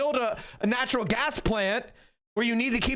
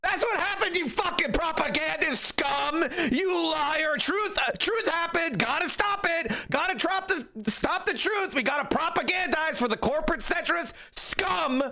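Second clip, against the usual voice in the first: higher, heated, hammed-up outrage; fired-up mock rant; 4 semitones higher than usual. Commentator mock-berating a centrist guest, heated and hammed up. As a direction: rant like a parody zealot spitting insults. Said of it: "I'm sorry for getting so fired up".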